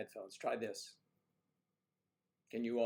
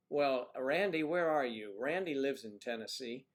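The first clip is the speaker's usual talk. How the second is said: usual speaking voice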